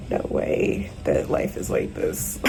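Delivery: slow, low whispered disbelief. Real, not acted. Worn-out vlogger whispers disbelief at her life, slow whisper. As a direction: whisper it like you can't believe it. Venting, exhausted, to camera.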